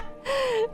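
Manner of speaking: high giggle that falls away; real high-pitched laugh. Real, not acted. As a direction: giggle after your own joke. Laughs after takeover joke.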